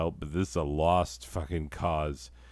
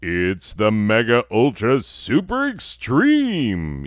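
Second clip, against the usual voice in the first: big, slow, louder booming announcer voice; 4 semitones higher, 6 dB louder, slower than usual. Shown as a demonstration. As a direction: boom it out like a showman. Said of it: "say it with a nice booming voice".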